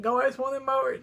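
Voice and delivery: lightly voiced elderly teacher, offhand; dismissive old teacher. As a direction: toss it off like a lazy old man. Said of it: "Y'all, I'm not kidding".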